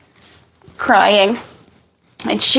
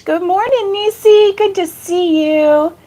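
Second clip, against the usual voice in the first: excited greeting; 7 semitones higher than usual. Real, not acted. Greeting live chat.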